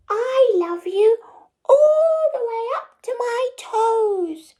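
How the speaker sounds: high squeaky little hare. Acted. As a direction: play the eager little bunny. Child hare voiced high.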